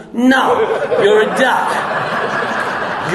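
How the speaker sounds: ordinary delivery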